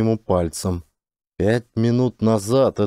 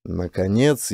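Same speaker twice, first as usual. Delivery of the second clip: ordinary delivery, nothing marked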